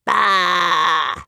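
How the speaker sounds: shouted scornful bah; angry roar. Acted. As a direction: roar it back angrily. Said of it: "the people roared angrily".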